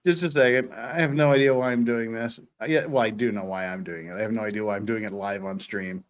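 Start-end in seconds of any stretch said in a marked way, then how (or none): none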